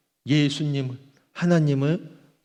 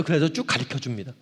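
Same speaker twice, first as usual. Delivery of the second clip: ordinary talk, nothing marked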